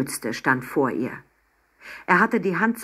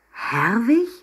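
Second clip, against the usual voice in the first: higher, exclaimed rising question; surprised cry; 6 semitones higher, 3 dB louder than usual. A narrator's reading. Girl recognises the professor's first name, crying out surprised. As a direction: call out the name in astonishment. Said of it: "rief Emma".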